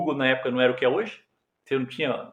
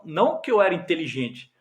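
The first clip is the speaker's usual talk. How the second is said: ordinary talk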